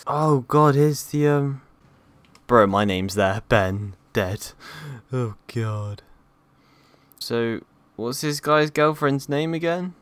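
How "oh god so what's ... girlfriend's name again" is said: ordinary talk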